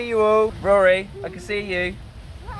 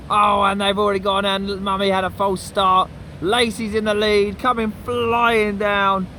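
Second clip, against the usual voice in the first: race commentary; 3 semitones lower than usual. Acted.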